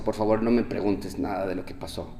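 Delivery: quietly begging his wife to leave it; weary plea. Retold or imitated. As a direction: hush it out, pleading not to be asked. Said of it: "le digo a mi esposa".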